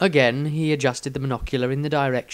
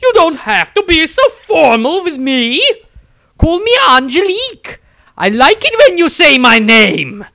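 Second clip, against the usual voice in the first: an adoring nun cooing at the wizard she loves; gushing besotted nun; 11 dB louder than usual. Acted. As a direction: swoon over him like a lovesick nun. Nun flirts.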